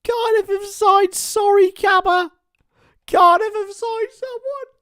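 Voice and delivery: high, loud, drawn-out cry of news; excited announcement. Real, not acted. Streamer announcing the new striker to chat, thrilled. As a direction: announce it like the best news ever.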